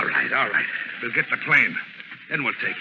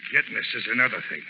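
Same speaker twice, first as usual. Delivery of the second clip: usual speaking voice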